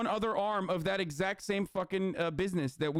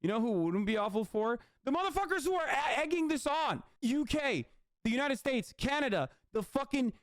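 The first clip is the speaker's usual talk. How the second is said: heated rant; 9 semitones higher than usual. Real, not acted. Ranting at the war hawks.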